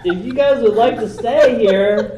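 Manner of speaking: cracking up mid-line; laughing through the words. Acted. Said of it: "He's like".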